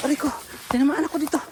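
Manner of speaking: high, fast yelp of pain; hurt exclamation. Real, not acted. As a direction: cry out after being hit. Hit by her bag.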